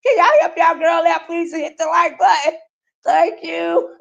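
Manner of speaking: high cutesy begging; playful pleading. Real, not acted. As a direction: sweet-talk the viewers into clicking. Begging viewers for likes.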